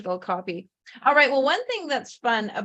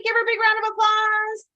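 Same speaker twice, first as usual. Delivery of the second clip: much higher, louder game-show call for applause; hyped announcer; 11 semitones higher, 4 dB louder than usual. Shown as a demonstration. Host rallying applause for the author, hyped announcer call. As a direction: pump up the crowd like a show announcer.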